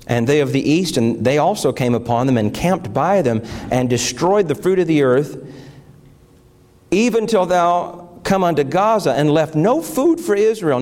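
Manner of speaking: solemn scripture reading. Acted. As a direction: read the Bible passage to the congregation. Reads scripture aloud.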